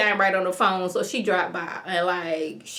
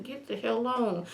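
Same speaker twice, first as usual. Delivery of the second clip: covert warning; 9 dB quieter than usual. Retold or imitated. Man secretly sending her away, hushed covert warning. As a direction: say it under your breath, for her ears only.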